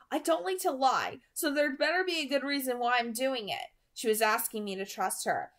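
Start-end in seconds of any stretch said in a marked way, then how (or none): 0.1-3.7 put-on higher girl's voice, firm; Bella's demand. A narrator's reading. Bella telling Edward she needs a reason, firm. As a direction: lay down the condition.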